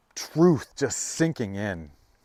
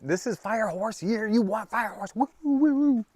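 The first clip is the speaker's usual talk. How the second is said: fire horse hype; 12 semitones higher than usual. Real, not acted. Reader cheering the client toward fire horse year, high whooping. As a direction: whoop it up way high like a rallying call. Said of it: "Is that too much?".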